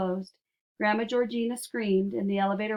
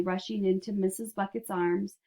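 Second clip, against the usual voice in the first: ordinary delivery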